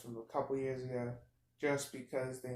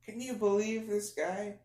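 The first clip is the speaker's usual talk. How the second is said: high, loud indignant exclamation; outraged; 8 semitones higher, 6 dB louder than usual. Real, not acted. Telling viewers about the cashier, loud and indignant. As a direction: demand the audience share your outrage.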